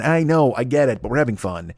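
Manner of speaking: ordinary talk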